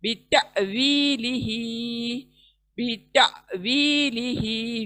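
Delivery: slow बीता वी लिही with a small catch in the throat after ता and वी held long; slow tajweed reading of बीता वी लिही with a throat jolt; recitation with a glottal catch. Shown as a demonstration. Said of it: "छोटा सा झटका हलक के अंदर".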